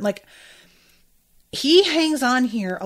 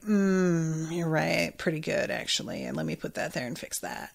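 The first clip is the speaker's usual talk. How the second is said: Envy; 3 semitones lower, 6 dB quieter than usual. Retold or imitated. Retelling how the villain answers Hughes, a low smug mock voice. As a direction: voice the shapeshifter smug and low, fading away. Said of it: "Envy is like".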